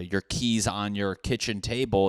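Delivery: ordinary talk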